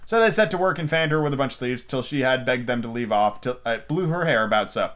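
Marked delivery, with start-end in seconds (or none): none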